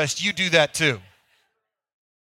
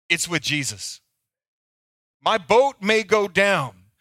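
ordinary delivery

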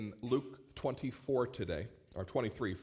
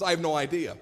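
ordinary talk

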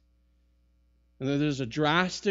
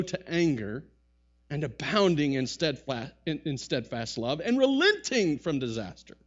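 angry prayer; 4 semitones higher, 6 dB louder than usual. Retold or imitated. Jonah's angry prayer voiced.

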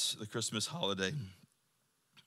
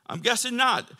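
ordinary talk

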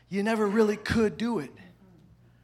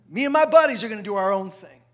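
put-on defiant older son; 10 dB louder, faster than usual. Older son voiced in parable.

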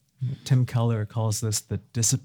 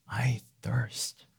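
faint unvoiced whisper; dying whisper of thirst; 9 dB quieter than usual. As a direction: breathe it out barely audible. Voicing Jesus on the cross.

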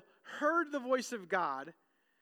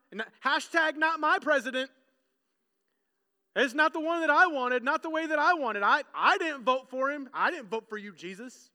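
loud, whiny protest, mocking the crowd; mock petulant complaint; 3 semitones higher, 6 dB louder than usual. Preacher mocks the crowd rejecting Jesus, loud and whiny. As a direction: sulk like a sore loser at an election. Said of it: "Their follow-up to Jesus' comment is".

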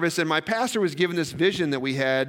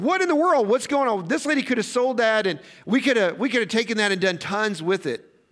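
mock-indignant Judas complaining about wasted perfume; upset protest; 5 semitones higher than usual. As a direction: burst out like an offended disciple. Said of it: "Judas got really upset".

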